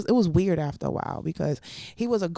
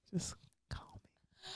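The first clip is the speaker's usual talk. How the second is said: breathy seductive whisper; 12 semitones higher, 22 dB quieter, much slower than usual. Acted. Inviting deaf dudes to call, sultry whisper.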